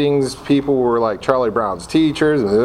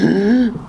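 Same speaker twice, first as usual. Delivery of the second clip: gasp; 8 semitones higher than usual. Retold or imitated. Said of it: "And I was like".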